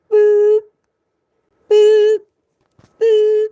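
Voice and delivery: high, loud electronic boops; three piercing mock chime tones; imitated door chime. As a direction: beep like closing train doors. Imitating train door warning chimes.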